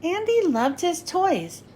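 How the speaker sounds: usual speaking voice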